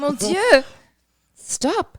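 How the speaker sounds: high, playful French gasp of delight; mock French exclamation. Teasing a friend about her French, high mock French exclamation. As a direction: put on a dainty French squeal.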